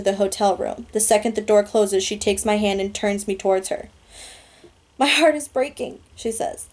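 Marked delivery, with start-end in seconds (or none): 5.0-6.0 higher-pitched, understated heartbreak; heartbroken. A narrator's reading. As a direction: voice a girlfriend's sorrowful goodbye, lightly. Heartbroken girlfriend's farewell in story.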